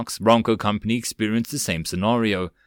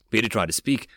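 plain reading voice

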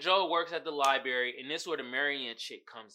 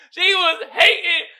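louder and higher, words through laughter; laughing loudly; 16 dB louder, slower than usual. Real, not acted. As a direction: choke it out laughing. Still laughing.

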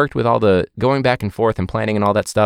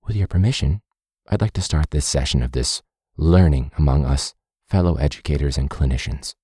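stiff presenter voice; 7 semitones lower than usual. Retold or imitated. Mocking presenter's formal opening.

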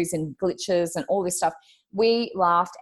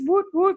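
woot woot; 11 semitones higher than usual. Retold or imitated. Only words heard from Beth.

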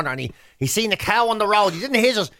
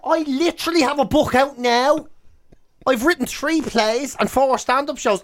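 indignant retort; 6 semitones higher than usual. Retold or imitated. Indignant retort to mother's friend.

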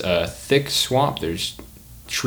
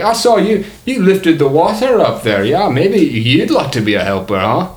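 put-on higher, louder muddled Australian-Scottish accent; jumbled Australian-Scottish host, pitched up; mixed-up comic accent; 7 semitones higher, 4 dB louder than usual. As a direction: coax a volunteer in a raised Australian-ish brogue. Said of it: "He's Australian and Scottish".

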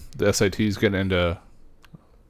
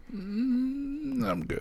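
reluctant refusal; 12 dB quieter, much slower than usual. Retold or imitated. Mock polite refusal of emails.

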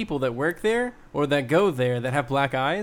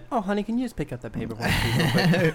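imitated sweetness; 2 semitones higher than usual. Berating woman suddenly asking her man a favor, mock sweetness. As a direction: turn suddenly honeyed, as an imitation. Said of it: "super berating, and then".

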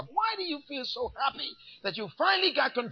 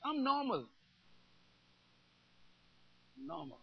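dropped aside; 4 semitones lower, 10 dB quieter than usual. Acted. Quiet aside after shouting.

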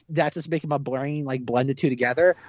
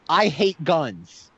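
slow, drawn-out imitation; slow, deliberate mock solemnity; mock movie-hero declaration; much slower than usual. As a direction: stretch each word for the camera. Mimics heroine's line to camera.

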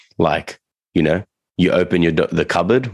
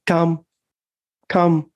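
high, measured recall cue, each word separate; dog-training recall tone; 11 semitones higher, much slower than usual. Shown as a demonstration. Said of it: "they give it that tone".

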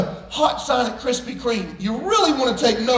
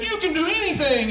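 raised-pitch call; retold fatherly call, lightly projected; 6 semitones higher than usual. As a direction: call it out for the echo to return. Dad calls out to echo.

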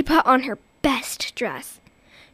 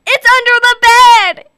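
loud, high panicked cry; terrified cry; 10 semitones higher, 14 dB louder than usual. Acted. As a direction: cry out in terror. Mother terrified.

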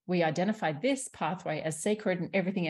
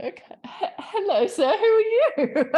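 mock terrified squeak; 9 dB louder, slower than usual. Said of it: "I'd be like".

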